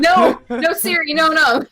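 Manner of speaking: high, loud burst of no's; real startled protest. Real, not acted. Host stopping Siri after it turned on by accident, startled. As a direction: call off the voice assistant in a fluster. Phone assistant switches on mid-recording.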